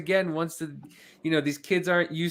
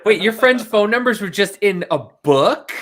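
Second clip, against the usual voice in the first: loud, higher incredulous question; mock disbelief; 4 semitones higher, 7 dB louder than usual. Kid learning numbers were in a book, mock disbelief. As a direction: act astounded by such an old-fashioned idea. Acting out kids baffled by phone books.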